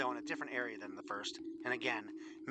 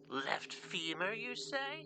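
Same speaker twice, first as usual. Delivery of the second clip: slow croak climbing to a high questioning end; ancient croaking voice; 11 semitones higher, much slower than usual. A narrator's reading. Ancient neighbour answering a call for a left femur, slow and croaking. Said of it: "came an ancient croaking voice".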